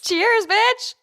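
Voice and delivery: high, loud playful cheers to a friend; rowdy toast. Real, not acted. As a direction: shout the toast with cheeky glee. Toasting with whiskey shots.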